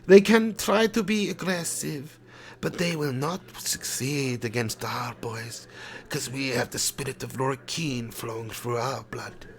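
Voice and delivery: lightly voiced German manager at a press conference; a manager fielding press questions in a slow mock German accent.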